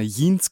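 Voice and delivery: Jeans with a Y sound, "Yeens"; Oma's mispronunciation. Retold or imitated. Grandchild imitating Oma who can't say English J, Jeans as "Yeens". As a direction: say Jeans starting like German J. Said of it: "dieses englische J scheinbar nicht aussprechen".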